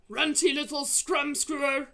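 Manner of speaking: loud, high jeering shout; insulting shout. Acted. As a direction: hurl the insult. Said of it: "he shouted".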